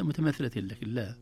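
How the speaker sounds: ordinary talk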